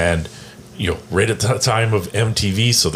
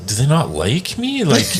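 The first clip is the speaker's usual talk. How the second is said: puzzled, wounded question; 6 semitones higher than usual. Retold or imitated. [name] wondering why his tourmates avoid him, puzzled and higher-pitched. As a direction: ask it as a baffled imitation, voice swooping up.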